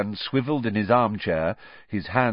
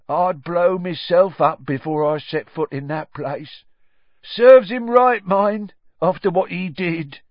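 slow, loud, higher character voice; 10 semitones higher, 8 dB louder than usual. A narrator's reading. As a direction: weigh out each word with conviction. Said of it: "said Ern in his slow voice".

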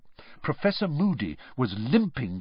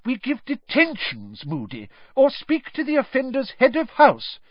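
high-pitched, insistent reproach; McGonagall's reproof; 13 semitones higher than usual. A narrator's reading. McGonagall tells Moody the proper punishments, high reproof. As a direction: lecture him in a high, agitated voice.

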